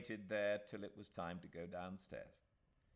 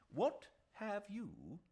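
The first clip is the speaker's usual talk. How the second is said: put-on eager old lawyer; 11 semitones higher, 5 dB louder, much slower than usual. A narrator's reading. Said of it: "said Mister Gilmore".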